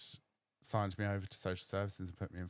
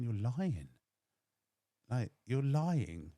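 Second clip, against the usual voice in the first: higher, louder, slow "you're lying"; accusing disbelief; 4 semitones higher, 5 dB louder, much slower than usual. Retold or imitated. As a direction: call him a liar in your head, drawn out. Inner retort to lying dad.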